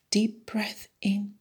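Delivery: ordinary talk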